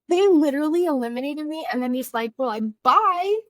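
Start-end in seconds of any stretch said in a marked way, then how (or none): 0.1-1.7 exasperated. Retold or imitated. Retold outburst to the judges. 2.4-3.4 high, loud, slow awkward goodbye; hammed-up embarrassed farewell; mock flustered bye. Judge's flustered brush-off retold.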